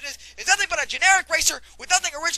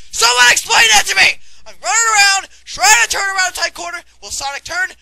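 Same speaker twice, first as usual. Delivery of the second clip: angry shout; 6 dB louder than usual. Acted. Ranting about the steering.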